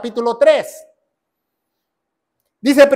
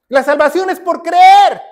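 high preacher's shout; emphatic proclamation; 4 semitones higher, 4 dB louder than usual. Real, not acted. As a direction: proclaim it, landing hard on "creer". Predicador proclama la doctrina. (proclamación enérgica en tono alto)

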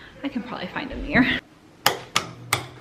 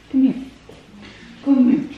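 calling dog; 4 semitones higher, 4 dB louder than usual. Real, not acted.

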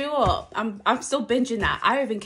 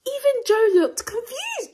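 high, loud, almost shouting; excited cry; 12 semitones higher, 5 dB louder than usual. Real, not acted. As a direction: blurt it out overexcited. Excited rave about trailer.

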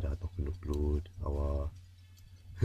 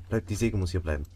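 usual speaking voice

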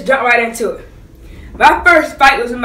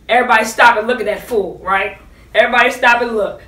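ordinary talk

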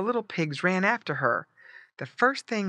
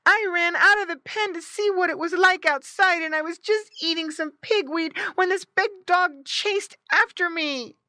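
high, squeaky stammering tale; high complaining piglet voice; squealing piglet; 5 dB louder than usual. A narrator's reading. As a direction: pour out your excuse as a little pig. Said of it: "squealed Squinty".

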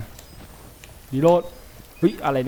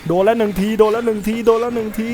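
frantic repeating; 8 semitones higher, 5 dB louder than usual. Real, not acted. Got hit by monster.

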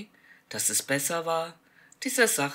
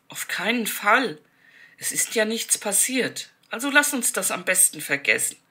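raised, rushed anxious pleading; hasty refusal; 6 semitones higher, 6 dB louder than usual. A narrator's reading. Martha begs Joanna to forget it in a hurried, anxious way. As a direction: talk her out of it in a hurry. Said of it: "hastig schüttelte Martha den Kopf".

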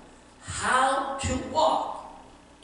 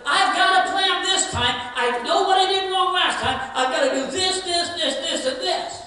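higher, louder, eager prisoner's promise; eager plan; 5 semitones higher, 5 dB louder than usual. Retold or imitated. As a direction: sound sure the plan will work this time.

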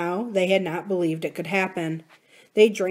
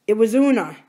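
hoarse, strained and louder; 3 semitones higher, 7 dB louder than usual. A narrator's reading. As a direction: rasp it out hoarsely. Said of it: "he said hoarsely".

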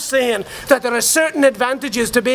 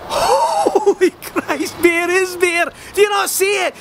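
higher, laughing, shouting with sudden realisation; ecstatic revelation; 6 semitones higher than usual. Acted. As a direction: cry it out like a man who has seen the light.

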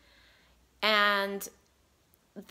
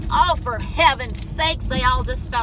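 exasperated; 8 dB louder than usual. Real, not acted. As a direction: groan it at the mess. Fruit snacks spill into cup holder.

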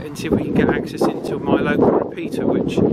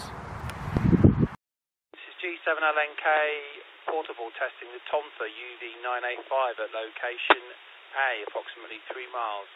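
much softer, through a radio speaker; tinny radio playback; over the radio; 9 dB quieter than usual. Shown as a demonstration. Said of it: "we're here with the, uh, the Tonfa".

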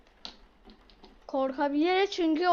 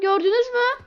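crying call to viewers; 8 semitones higher, 6 dB louder than usual. Real, not acted. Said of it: "Gördünüz mü arkadaşlar".

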